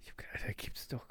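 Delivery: hushed, amazed whisper. Real, not acted. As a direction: whisper it in disbelief. Awed aside about the cupboards. (staunend geflüstert)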